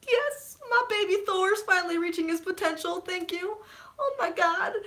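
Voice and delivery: high, thrilled fan praise; overjoyed gushing. Retold or imitated. Fan in the theater celebrating Thor, overjoyed. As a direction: squeal it like a proud parent. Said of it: "I was like".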